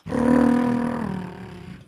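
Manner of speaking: long buzzing engine noise; vocal imitation of a toy car motor; toy car sound. Shown as a demonstration. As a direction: make a sustained little motor whirr. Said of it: "give us a sound effect for a toy car".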